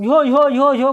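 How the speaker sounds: loud herding cry; shooing call. Retold or imitated. Villagers yelling at nilgai to run them off, loud. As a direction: holler to scare off the nilgai. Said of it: "अपना इधर आदमी सब".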